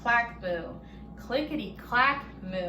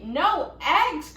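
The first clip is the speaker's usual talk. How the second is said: loud, higher outraged cry; cried out; 5 semitones higher, 6 dB louder than usual. A narrator's reading. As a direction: shout it like a furious farmer. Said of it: "cried Farmer Brown".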